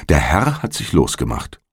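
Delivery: ordinary delivery, nothing marked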